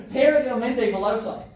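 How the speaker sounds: Damien Del Bosch. Acted. Showing off Italian to the team, loud character accent. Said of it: "saying Italian in Damien Del Bosch's voice".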